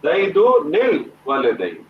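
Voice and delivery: زیدو نل والدین stretched out slowly, sounds joined; teacher modelling slow connected recitation; slow joined reading. Shown as a demonstration. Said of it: "اب آپ اس کو پڑھیں".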